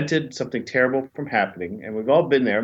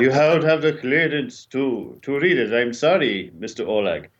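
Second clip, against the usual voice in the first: bad Russian accent. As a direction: put on a cheesy Bela Lugosi-like Russian accent. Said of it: "in my bad Russian accent".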